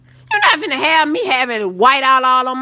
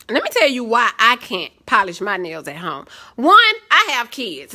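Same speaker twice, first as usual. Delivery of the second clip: ordinary talk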